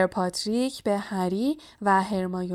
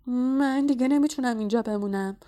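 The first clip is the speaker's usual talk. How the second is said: acted shivering complaint, slightly raised; teeth chattering; 3 semitones higher than usual. A narrator's reading. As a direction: talk as if freezing cold. (لرزان از سرما)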